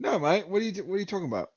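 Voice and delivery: imitated British guy. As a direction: put on a puzzled Brit's accent. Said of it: "I went to the British guy".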